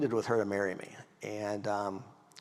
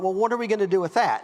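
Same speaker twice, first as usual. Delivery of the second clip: higher, louder, fast puzzled question; baffled question; 7 semitones higher, 6 dB louder, faster than usual. Retold or imitated. Young husband to father-in-law about the boxes, fast and baffled. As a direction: rattle off the question, bewildered.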